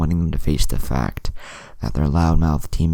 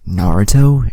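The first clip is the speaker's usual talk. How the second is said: high, loud horrified cry of a name; shocked gasp on finding him; teacher finds his bloodied student, horrified; 8 semitones higher, 11 dB louder than usual. Acted. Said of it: "Iruka gasped".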